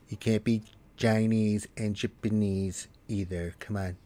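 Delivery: lightly voiced talk-show hostess; Linda. Streamer as Linda hosting a coffee talk on Canadians, lightly voiced. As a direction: play a chatty hostess throwing out a topic to discuss. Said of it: "Just call me Linda".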